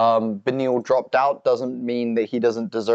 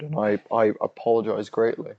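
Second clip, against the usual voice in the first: ordinary delivery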